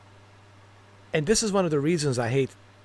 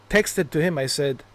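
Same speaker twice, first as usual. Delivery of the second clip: ordinary talk, nothing marked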